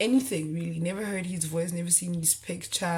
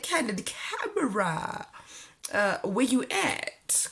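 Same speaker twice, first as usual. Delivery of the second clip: slow, higher, sarcastic calling out; teasing disbelief, drawn out; mock incredulous; 4 semitones higher, slower than usual. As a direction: look around for the hidden camera. Mocking hidden camera joke.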